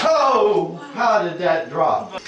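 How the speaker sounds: a slow, high, incredulous drawl; slow amazed disbelief. Real, not acted. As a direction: stretch each word, stunned. Repeats question about the bottle.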